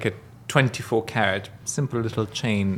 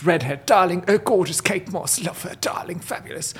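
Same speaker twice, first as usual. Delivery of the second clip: posh English darling; 7 semitones higher than usual. Retold or imitated. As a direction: trail off in a breathy English gush. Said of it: "Oh, she always talks like this".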